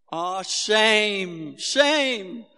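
loud, high cooing "Ah, shame"; sympathetic "shame". Retold or imitated. South African mum on the sideline, loud cooing sympathy. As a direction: gush sympathy at the fallen boy.

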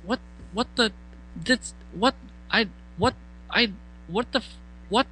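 high, halting, lost for words; stunned sputter. Retold or imitated. At the band table, stammering high in shock. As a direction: sputter in high-pitched confusion. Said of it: "I'm like".

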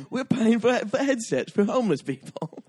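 outraged, high-pitched fake Australian accent; mock-outraged taxpayer with a dodgy Australian accent; bad Australian accent. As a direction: complain loudly in a badly faked Australian accent. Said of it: "your Australian accent is worse".

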